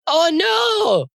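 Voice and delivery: mock shout of dismay. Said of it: "she says".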